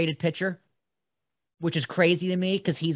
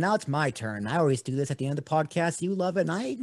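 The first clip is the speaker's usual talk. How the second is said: normal talking voice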